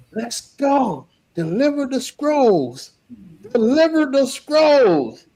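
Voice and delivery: high, slow commanding call; commanding general. Retold or imitated. General calling the marchers to deliver the scrolls, slow commanding call. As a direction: order the troops like a general at the finish. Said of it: "you see General Mahane like".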